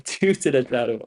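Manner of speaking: words tumbling out of a laugh. Real, not acted. Streamer counting banned viewers, laughing. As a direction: laugh as you count the banished.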